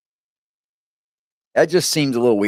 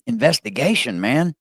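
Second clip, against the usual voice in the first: usual speaking voice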